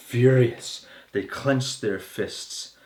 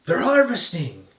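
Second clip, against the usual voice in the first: raised, higher voice, mildly shouted; accusing shout; 6 semitones higher, 4 dB louder than usual. A narrator's reading. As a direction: call it out like outraged accusers. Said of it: "and they're shouting".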